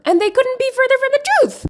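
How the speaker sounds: very high, fast comic squeal; mock squeaky protest. Comedian denying she lacks humor, mock squeaky protest.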